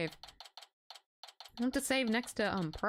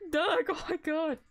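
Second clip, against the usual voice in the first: laughing; 8 semitones higher than usual. Real, not acted. Streamer laughing at game line.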